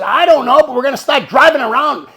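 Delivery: high, shouted admission of no plan; retold flustered yelling; frantic loud reply. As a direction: shout it back, set on going anyway.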